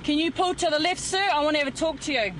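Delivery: calling out. Real, not acted. Officer pulling over a driver.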